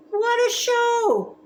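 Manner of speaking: high delighted exclamation; amazed. Acted. Child watching the Cycle Clowns, amazed and delighted. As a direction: marvel at the show.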